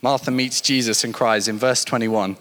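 normal talking voice

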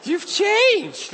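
put-on high, far-from-natural girl voice; hammed-up admiring astonishment; amazed. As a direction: imitate her, pitch soaring. Retold girl admiring his change.

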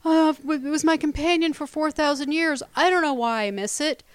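put-on whiny complaint; mock whining. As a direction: whine it mockingly, like a self-pitying complaint. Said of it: "whining about her box".

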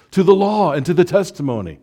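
high, loud rallying call; ringing charge to the congregation; proclaimed like a battle cry. Shown as a demonstration. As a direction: lift it up like a banner slogan. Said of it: "a word of charge".

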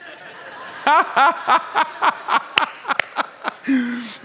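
high bursting laugh; big laugh. Real, not acted. Laughing at own joke.

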